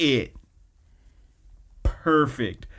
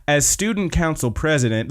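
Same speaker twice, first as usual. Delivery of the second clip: higher, insistent mock speech voice; acted resolute restart, pitched up; pointedly ignoring interruption; 4 semitones higher, 3 dB louder than usual. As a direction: carry on pointedly over the objection. Speech resumed over objection, ignoring him.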